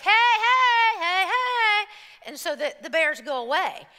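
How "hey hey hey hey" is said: loud, high calls of hey; calling hey. Retold or imitated. Hikers calling out to warn bears, loud high hey. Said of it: "we're like".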